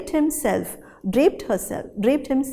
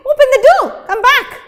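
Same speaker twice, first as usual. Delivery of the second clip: loud, high call at the door; loud husband calling; 14 semitones higher, 13 dB louder, faster than usual. Acted. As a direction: shout through the door. Husband knocking at night.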